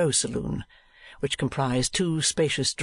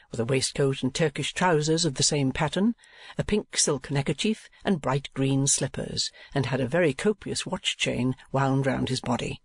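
plain reading voice

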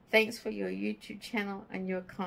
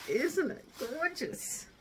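emotional; 6 semitones higher, 3 dB quieter, slower than usual. Real, not acted. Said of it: "I'm a bit emotional".

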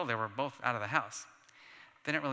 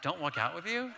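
"Don't walk out with you?" with a rising question on "you"; incredulous echo; 3 semitones higher than usual. Retold or imitated. Mark echoing his brother's words back, stunned and rising. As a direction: throw the words back in disbelief. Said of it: "I'm like".

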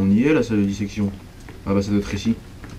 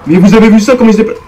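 loud, high disbelieving exclaim; stunned, calling viewers to look; shocked outcry; 9 semitones higher, 10 dB louder, faster than usual. Real, not acted. Player shows viewers how the monster moves, shocked outcry.

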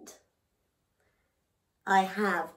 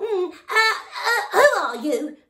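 loud frog; 10 semitones higher, 11 dB louder than usual. Acted. Noisy frog loudly introducing himself to the elephant. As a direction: proudly bellow the name.

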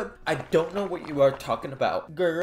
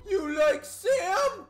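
shocked laughing cry; 13 semitones higher, much slower than usual. Acted. Shocked reaction to crush reveal.

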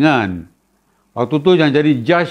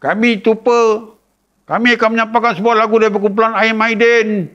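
formal band intro; 6 semitones higher than usual. Retold or imitated. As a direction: introduce the song like a nervous kid on stage. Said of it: "Dia ingatkan dah nak start". (budak memperkenalkan lagu dengan suara tinggi dan perlahan)

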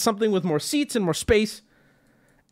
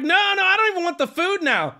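high-pitched mock huff; joking protest; 9 semitones higher than usual. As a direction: refuse in a high, jokingly offended voice. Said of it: "I'm smiling and joking through it".

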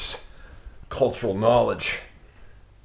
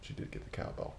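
ordinary delivery

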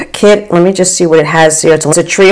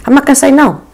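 much higher, bright teasing sing-song; playful eager hint; 9 semitones higher, faster than usual. Real, not acted. Vlogger hinting to the brand for free packages, eager. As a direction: drop the hint with a grin. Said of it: "if you're listening and you wanna send me".

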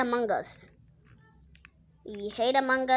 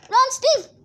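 high urgent call; urgent shout; 10 semitones higher, 4 dB louder than usual. Real, not acted. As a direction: yell for him to run.

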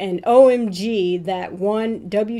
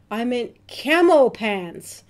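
ordinary talk

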